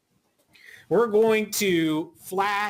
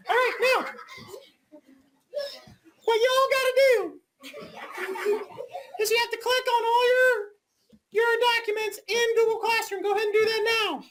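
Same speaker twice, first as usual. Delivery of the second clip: Mrs Overton voice. Pretend. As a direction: keep playing the substitute, pitched way up. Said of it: "Missus Overton mode".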